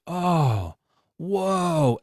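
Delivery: a wide-eyed, mocking gasp of wonder; mock awe. Mocking viewer awed by halftime fireworks, breathy wonder.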